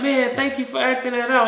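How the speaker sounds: high-pitched mock gratitude; gushing thanks. Spectator thanking the person who lived the life, gushing thanks. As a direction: thank them like a thrilled fan.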